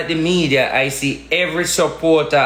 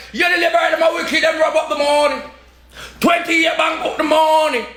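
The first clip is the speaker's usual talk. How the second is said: retold shout; 14 semitones higher than usual. Retelling what he shouted at another comrade, high mock yell. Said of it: "He told another comrade".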